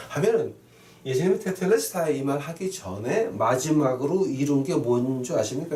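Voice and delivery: ordinary talk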